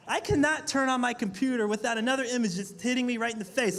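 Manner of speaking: ordinary delivery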